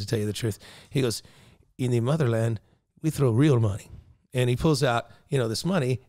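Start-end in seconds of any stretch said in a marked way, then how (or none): 1.8-3.9 rough attempt at a Russian accent, measured phrases. Retelling a rich Russian's challenge at a club, imitated Russian accent. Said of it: "if this is even a Russian accent".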